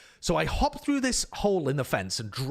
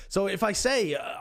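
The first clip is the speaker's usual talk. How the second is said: ordinary delivery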